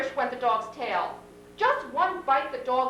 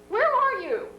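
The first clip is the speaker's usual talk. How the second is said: surprised; 9 semitones higher, 4 dB louder than usual. A narrator's reading. Mother startled by son calling.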